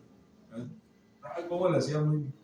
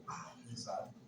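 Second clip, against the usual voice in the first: whispered "Padre santo" under the breath; hushed astonished whisper; awed whisper; 5 semitones lower, 16 dB quieter, much slower than usual. Retold or imitated. Awed on finding the money.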